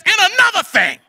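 high, feisty "and another thing"; a sassy parting shot; feisty comeback. Retold or imitated. Character comes back out, higher.

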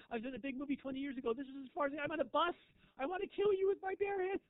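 high, exasperated self-pitying rant; ranting has-been. Retold or imitated. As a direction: whine it out like a washed-up star on a bus. Has-been's rant continues.

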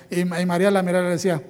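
usual speaking voice

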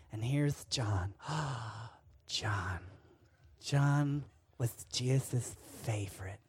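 slow, hushed storytelling voice that drops to a whisper; a teacher spinning a flannel-board tale in a low, secret hush; dramatic Sunday school teacher. Retold or imitated. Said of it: "my teacher going".

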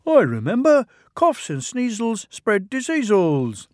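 high little engine reciting; twin engine voice. Acted. Bill remembering the shed notice, high and playful. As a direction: rattle off the rhyme, piping.

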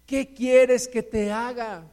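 slow, deliberate challenge; emphatic asking. Acted. Jesus challenging a believer's small prayer, slow emphatic asking. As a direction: press each word of the question slowly.

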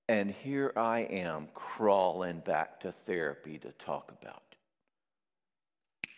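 slow, fading into a breathy whisper; self-pitying mumble. Retold or imitated. Client admitting he's back in therapy, slow self-pitying fade to a whisper.

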